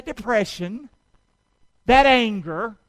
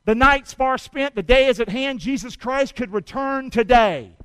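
1 preaching; 2 semitones higher, 6 dB louder than usual. Real, not acted. Preaching at full force.

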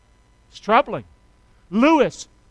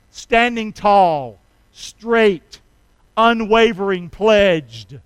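slow, loud and emphatic; preaching at full force; 9 dB louder, much slower than usual. Acted. Preacher to church, slow loud proclamation. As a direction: plant every word like a banner.

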